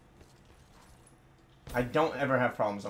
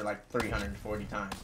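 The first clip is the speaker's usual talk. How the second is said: ordinary talk, nothing marked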